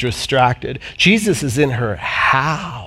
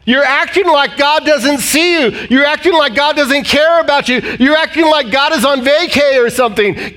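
high, raised mock-incredulous challenge; Jesus chiding the worried disciples, higher and urgent; Jesus challenging; 9 semitones higher than usual. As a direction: push it up high like a pleading rebuke.

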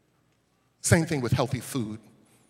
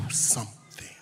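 whisper; 6 dB quieter, slower than usual. Acted. Whispered key line.

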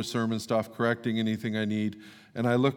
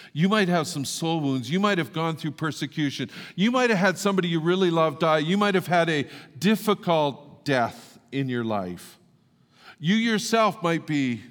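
preaching with mounting force, louder; rising sermon delivery; 6 semitones higher, 5 dB louder than usual. Acted. Pastor pressing the congregation about soul wounds, building preaching. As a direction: drive each line harder than the last.

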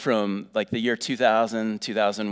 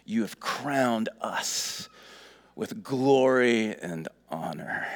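slow reading; slower than usual. Shown as a demonstration. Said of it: "This is David's statement".

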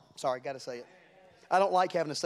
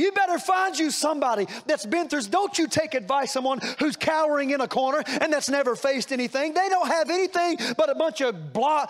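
preaching at full force; 8 semitones higher than usual. Acted. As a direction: lift it into a shouted sermon.

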